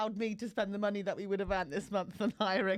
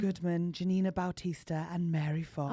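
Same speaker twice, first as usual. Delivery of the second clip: low, lightly sultry sexy voice; 6 semitones lower than usual. Pretend. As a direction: purr the names a touch seductively. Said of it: "I need to do something like a sexy voice".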